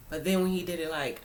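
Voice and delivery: normal talking voice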